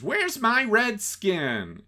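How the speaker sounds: put-on high, slow, airy girly voice; high slow mock-innocent question; ditzy girlfriend. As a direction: play the dumb blonde asking sweetly. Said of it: "And she says".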